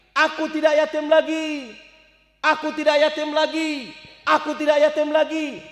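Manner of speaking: put-on high, loud child's cry of joy; a boy showing off his new clothes, yelling with joy; joyful shout. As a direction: shout it out to the whole street, overjoyed. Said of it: "seger, teriak".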